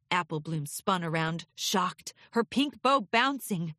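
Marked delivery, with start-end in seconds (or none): none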